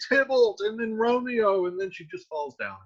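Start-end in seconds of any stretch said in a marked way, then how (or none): 0.1-0.6 high-pitched mild mock cry; retold scream. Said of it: "and then she'll scream". 0.9-1.6 high-pitched mild mock cry; retold scream. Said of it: "and then she'll scream".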